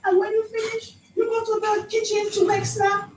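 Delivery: high, incredulous mock outrage; incredulous disbelief. Retelling a coworker's habits, high incredulous disbelief. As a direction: pitch it up in disbelief.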